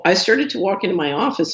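ordinary talk, nothing marked

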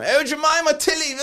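high, hammed-up posh mum calling her kids; pretend posh mother. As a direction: call out like a plummy King's Road mother. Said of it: "your pretend posh accent there".

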